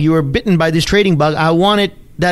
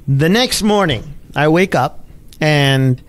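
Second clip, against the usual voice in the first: normal talking voice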